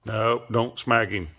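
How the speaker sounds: slow, firm warning; firm scolding. Real, not acted. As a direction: scold a pet slowly and firmly. Scolding a misbehaving pet.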